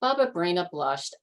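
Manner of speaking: usual speaking voice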